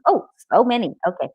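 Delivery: ordinary talk, nothing marked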